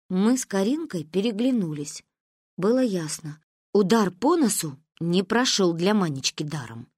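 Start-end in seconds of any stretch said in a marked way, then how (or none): none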